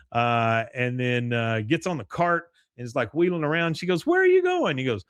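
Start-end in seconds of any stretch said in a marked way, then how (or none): 4.1-4.8 very high, louder mock shout; mock calling out. Peggy calling after Hank on the cart, mock high call.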